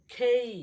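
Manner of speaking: "খেই" loud and high, with a puff on kh; aspirated K. Shown as a demonstration. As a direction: drill "খেই", strong kh gliding to ay-ee. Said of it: "কি বলবো?". ("খেই" জোরে, বাতাস দিয়ে)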